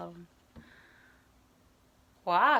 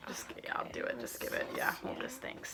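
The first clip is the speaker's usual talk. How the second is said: whispering; 14 dB quieter than usual. Real, not acted. Whispering to camera.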